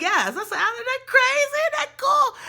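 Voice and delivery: high, loud amazed friend; a high, loud, thrilled friend's voice; excited friend. Retold or imitated. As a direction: gush about how cool it is.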